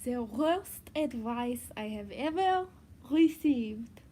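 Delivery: slow, hammed-up French accent. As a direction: attempt a French accent, taking it slowly. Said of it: "answer a question in a French accent".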